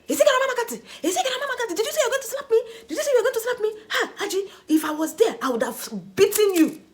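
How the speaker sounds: retold furious yelling. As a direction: shriek his outrage at the mother-in-law. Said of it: "he was raising his voice at her".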